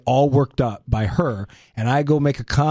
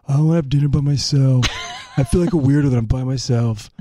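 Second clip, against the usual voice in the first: surfer-ish imitation. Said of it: "a weird surfer vibe".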